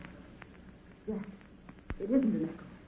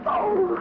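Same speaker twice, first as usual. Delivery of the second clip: short, high, loud gasp of fear; frightened cry; 9 semitones higher, 5 dB louder than usual. Acted. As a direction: cry out as the cold water hits. Water over her feet.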